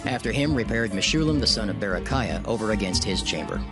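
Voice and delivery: normal talking voice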